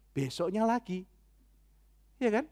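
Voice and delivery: ordinary talk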